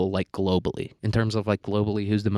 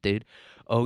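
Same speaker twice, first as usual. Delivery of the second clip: ordinary delivery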